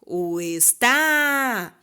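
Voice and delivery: "está" called out with final TÁ held long; very slow "está", all weight on TÁ; calling out stressed syllable. Shown as a demonstration. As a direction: call out "está", stretching the TÁ. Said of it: "quando eu chamo a palavra".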